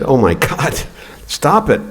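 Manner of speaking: high, exasperated mom voice; mock-shocked elderly mother telling him to stop; mom protesting. Mom scolding him in story.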